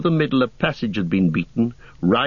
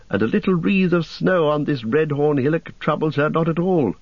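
higher, light elvish voice; elf voice; 6 semitones higher than usual. Acted. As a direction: speak as a cheerful elf. Legolas speaks to company.

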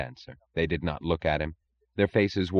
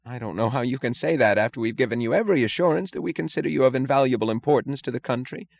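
higher, fast pleading voice; whiny official; 6 semitones higher, faster than usual. A narrator's reading. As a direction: gabble defensive excuses, higher. Mooch voiced, higher and quicker.

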